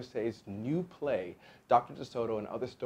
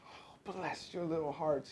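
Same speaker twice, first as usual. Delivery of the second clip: slow, breathless grateful gush; gushing cry; 6 semitones higher, 5 dB quieter, slower than usual. A narrator's reading. Fox falling to his knees before the dentists, gushing cry. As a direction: draw it out in soft, overcome gratitude. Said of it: "he cried".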